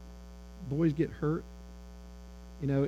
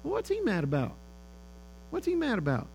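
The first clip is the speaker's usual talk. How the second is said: higher-pitched, mildly baffled aside; acted bewilderment, pitched up; puzzled mom; 7 semitones higher than usual. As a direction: wonder aloud as a slightly put-out mother. Mom's puzzled reaction to son's yell.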